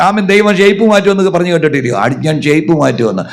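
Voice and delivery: high, loud fervent sermon; fervent pulpit preaching. Real, not acted. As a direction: preach at full force. Full-force preaching.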